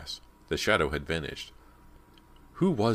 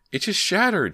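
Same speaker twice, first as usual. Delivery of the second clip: very high, loud shaken outburst; dazed cry; 7 dB louder than usual. A narrator's reading. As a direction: blurt it out in shock. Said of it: "still dazed".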